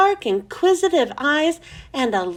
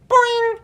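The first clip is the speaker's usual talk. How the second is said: high loud twangy boing; broken guitar string boing; 10 semitones higher, 4 dB louder than usual. Retold or imitated. The frog calling again, loud twangy boing. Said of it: "He sounded like a broken guitar string".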